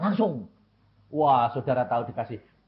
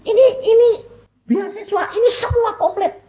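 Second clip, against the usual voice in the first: much higher and louder, pressing the point; eager pleading; 5 dB louder than usual. Retold or imitated. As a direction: show off the full scholarship eagerly. Pleading over scholarship. (mendesak dengan semangat, nada tinggi)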